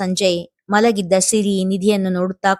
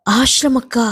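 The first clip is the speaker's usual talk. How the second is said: sob; 4 semitones higher than usual. A narrator's reading. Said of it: "ಬಿಕ್ಕಿದಳು Swati".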